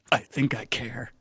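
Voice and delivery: soft, slow, choked-up admission; a tough shark admitting feelings through tears; teary. Acted. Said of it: "the shark just has tears in his eyes".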